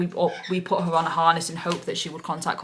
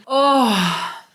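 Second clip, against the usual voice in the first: relieved gasp; 6 semitones higher, 6 dB louder than usual. Real, not acted. Overwhelmed relief.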